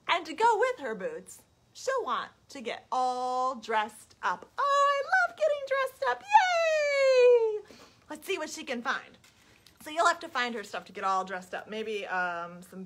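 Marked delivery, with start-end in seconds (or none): 4.6-7.8 very high, loud squeaky pig demanding syrup; pig character. Acted. The pig begging the child for maple syrup, squealing high. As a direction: squeal it like an eager piglet.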